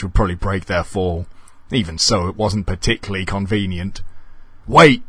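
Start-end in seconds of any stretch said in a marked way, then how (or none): 4.7-5.0 high, loud desperate cry; urgent acted shout; screamed. A narrator's reading. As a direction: yell to stop them leaving. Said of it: "screamed the door".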